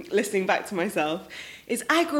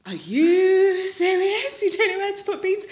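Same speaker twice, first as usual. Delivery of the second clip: incredulous mocking; 9 semitones higher than usual. Student to flatmate holding unopened beans, incredulous. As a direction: act out scornful amazement, pitched right up.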